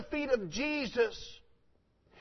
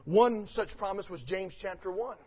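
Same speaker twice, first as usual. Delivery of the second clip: normal talking voice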